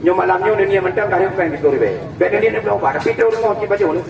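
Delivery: normal talking voice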